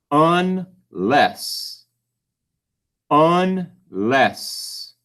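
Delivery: slow dictation, each syllable held apart. Shown as a demonstration. Teacher dictates a word to spell, syllable by syllable. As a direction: split in two, a long gap between syllables. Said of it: "You go ahead and write it out".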